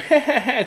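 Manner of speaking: a short, high mock laugh; imitated laugh. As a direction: laugh like the pleased old teacher. Teacher laughs at her joke.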